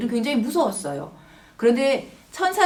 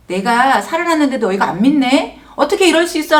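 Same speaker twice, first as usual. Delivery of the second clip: mock scolding; 3 semitones higher, 7 dB louder than usual. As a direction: act out a telling-off, building in pitch. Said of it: "야단치는 것이 아니라".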